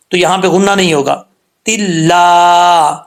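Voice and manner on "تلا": slow drawn-out tajweed recitation; practice recitation. Shown as a demonstration. Teacher gives practice line, slow recitation. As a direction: recite slowly for them to copy.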